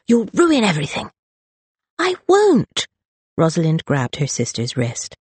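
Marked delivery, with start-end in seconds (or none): 0.1-1.1 Charlotte snapping at her sister in the kitchen, angry; angry snap. Acted. Charlotte snaps at Rosalind. 2.0-2.9 high, loud defiant refusal; defiant cry. Acted. Rosalind defies her sister.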